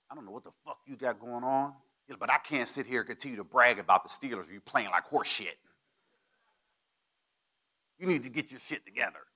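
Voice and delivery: hammed-up chewing out from an old mentor; Bill yelling. Mentor scolding him at the Hall of Fame, mock gruff. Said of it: "Bill's yelling at me".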